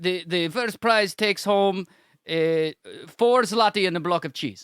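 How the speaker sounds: hammy put-on Polish accent. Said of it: "I can't do a Polish accent".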